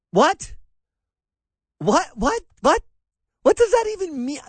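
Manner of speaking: high, loud bewildered what; disbelieving cry of what; stunned by the quote, rising pitch; baffled. Real, not acted.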